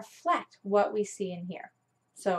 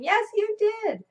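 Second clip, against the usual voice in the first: imitating a screaming child; 13 semitones higher, 4 dB louder, slower than usual. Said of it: "And M L started screaming".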